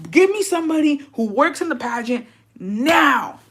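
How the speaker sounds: high, whiny mock tantrum; hammed-up demand. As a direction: demand it like a worked-up diva. Mock demand for pageant credentials.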